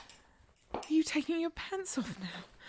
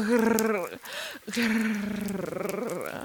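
much lower, long rolled cat growls; 9 semitones lower than usual. Acted. Catgirl stuck in a window, growling at her partner.

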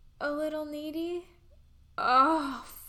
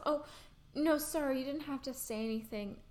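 ordinary talk

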